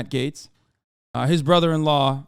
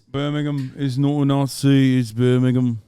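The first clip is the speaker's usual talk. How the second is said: put-on Birmingham accent.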